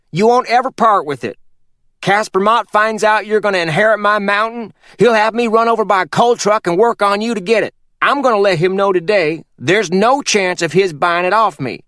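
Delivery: put-on a sly old mountain man giving orders; Purvis voiced. Purvis lays down the inheritance.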